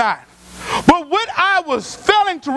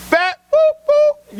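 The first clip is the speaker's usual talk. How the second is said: high mocking heckler voice, bleeping the insult; 12 semitones higher, much slower than usual. South Dallas heckler taunting the pastor, high mock jeer. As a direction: toss out the jeer in a high mocking voice, censoring with a boop.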